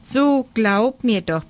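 high, drawn-out pleading; piping plea. A narrator's reading. Franz begs the boy to believe him, piping. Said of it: "piepste der Franz".

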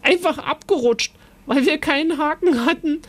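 high, giggly storybook voice; amused, laughing. A narrator's reading. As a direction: tell it as if tickled by the mishap. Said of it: "sagte er lachend".

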